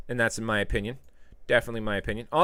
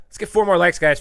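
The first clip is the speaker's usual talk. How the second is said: eager plea; 5 semitones higher, 8 dB louder than usual. Real, not acted. Repeated plea.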